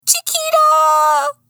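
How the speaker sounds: very high, drawn-out yell; alarmed shout. A narrator's reading. Sidekick shouting as heroine takes off, high yell. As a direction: shriek the name, stretched long.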